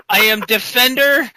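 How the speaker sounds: hint of a pompous hero proclaiming his titles; Chad Sexington. Paladin declares his titles to the party, mock-heroic. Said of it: "it's so over the top".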